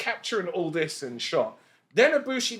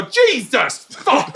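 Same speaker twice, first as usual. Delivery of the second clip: higher, louder and slow, a pained yelp through a laugh; startled yelp; 8 semitones higher, 7 dB louder, much slower than usual. Retold or imitated. Camera guy shot by a firework, laughing yelp. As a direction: blurt it out like you've just been hit by a firework. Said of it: "you just hear him on the camera go".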